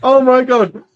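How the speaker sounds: high, loud laughing oh my God; cracking up at the joke; laughing exclamation. Real, not acted. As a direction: burst out laughing through it.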